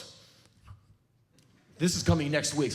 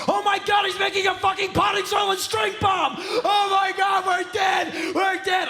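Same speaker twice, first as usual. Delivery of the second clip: shrieking, much higher and louder hysterical neighbor; mock panic; 5 dB louder than usual. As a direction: scream like a terrified neighbor.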